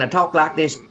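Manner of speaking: a light put-on Southern accent, half-joking. Shown as a demonstration. DJ showing Canadian friends what a real Southern accent would sound like, lightly put-on Southern accent. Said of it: "if I had a Southern accent".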